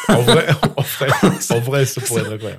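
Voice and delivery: cracking up mid-sentence; laughing. Real, not acted. Actor pictures a ch'ti cowboy version, laughing. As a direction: start high and loud, laughter breaking up the words.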